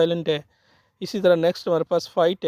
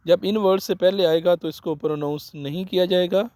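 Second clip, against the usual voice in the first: ordinary delivery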